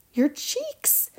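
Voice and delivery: high-pitched alarm without volume; contained scream. A narrator's reading. Mother spots Violet's cheeks changing, contained horrified scream. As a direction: yelp it in horror, held back. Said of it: "screamed Missus Beauregard".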